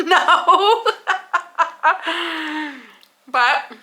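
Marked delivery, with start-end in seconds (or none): 0.0-2.7 laughing. Real, not acted. Laughing at son's blunt verdict.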